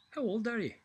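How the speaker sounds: a hint of a keen young lad asking; young apprentice. Apprentice voiced in joke.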